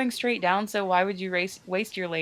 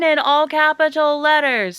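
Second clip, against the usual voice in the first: booming all-caps voice; loud; 8 semitones higher, 8 dB louder than usual. Pretend. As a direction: shout it like capital letters. Dialogue written in all capitals.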